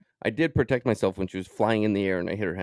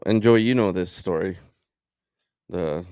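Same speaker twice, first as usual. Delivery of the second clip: usual speaking voice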